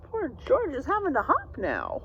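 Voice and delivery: plain reading voice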